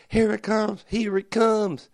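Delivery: high-pitched Southern ladies calling out; high, drawn-out Southern accent call. Retold or imitated. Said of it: "why they're in a Southern accent".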